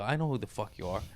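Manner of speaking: soft, fast starstruck reply; soft quick dazed reply; awestruck. Retold or imitated. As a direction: mutter it in awe. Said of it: "I go".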